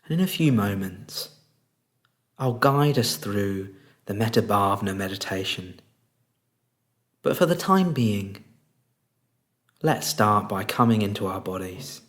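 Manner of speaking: soft, unhurried meditation voice with long pauses; guided meditation. Real, not acted. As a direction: guide them gently into stillness, leaving space between phrases.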